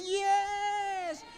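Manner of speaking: high, eager "Yes!" from the crowd; crowd answer. Retold or imitated. Crowd answers the speaker's question, high eager yes. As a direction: answer like a keen audience member. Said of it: "Siyempre sabi".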